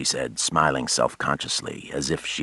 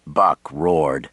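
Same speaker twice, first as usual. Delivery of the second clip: ordinary delivery, nothing marked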